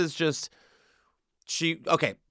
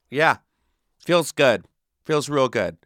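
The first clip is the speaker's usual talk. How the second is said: usual speaking voice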